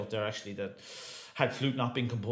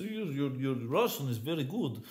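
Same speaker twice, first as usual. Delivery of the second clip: lightly put-on Russian accent, quoting a coach; a hint of a hammed-up Russian coach; terrible Russian accent. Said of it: "it's a terrible Russian accent".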